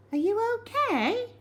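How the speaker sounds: put-on high, concerned girl asking; girl's voice. A narrator's reading. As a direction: check on him gently. Cherry asks Algy.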